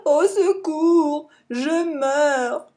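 drawn-out mock wail, high and slow; fake cries for help. Jean-Claude fakes an injury to lure the bison, mock wail. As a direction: pretend to be hurt, hammed-up. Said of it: "comme s'il était blessé".

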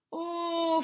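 a small guilty oh; a bit guilty. A narrator's reading. Bear realising his fault, a little guilty. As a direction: caught out. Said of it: "un peu coupable".

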